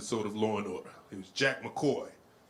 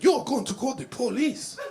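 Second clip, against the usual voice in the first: put-on high, loud, incredulous Haitian accent; Haitian lady; 12 semitones higher, 5 dB louder than usual. Haitian lady challenges the threat of police, high accented question. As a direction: throw it back high in a mock Haitian accent. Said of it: "She said".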